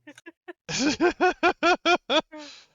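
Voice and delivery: real burst of laughter; big loud laugh. Real, not acted. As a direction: laugh out loud high and hard. Laughs at the exchange.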